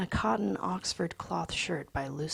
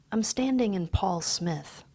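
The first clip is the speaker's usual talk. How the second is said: ordinary delivery, nothing marked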